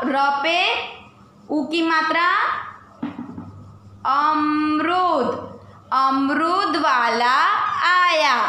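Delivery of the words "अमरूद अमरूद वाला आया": slow reading aloud. Shown as a demonstration. Teacher sounding out a word with pupils, slow. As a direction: say अमरूद very slowly, drawing each syllable. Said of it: "तो चलो मेरे साथ लगाओ".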